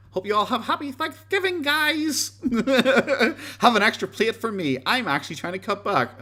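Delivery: very high-pitched, loud mock-cheery voice with a chuckle; mocking Boogie impression. Streamer reading Boogie's Thanksgiving post to viewers, high mocking voice. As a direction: squeal the post out in a silly high voice.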